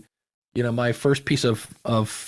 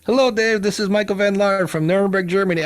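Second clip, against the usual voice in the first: imitating the German listener; 7 semitones higher than usual. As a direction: replay the caller's greeting, pitched up and eager. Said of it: "hit play".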